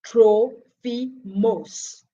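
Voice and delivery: "Trophimus" very slowly, syllable by syllable, tro-fee-mos; slow, separated Greek "Trophimus", ending in mos; Greek pronunciation. Shown as a demonstration. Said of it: "whose name is pronounced in Greek".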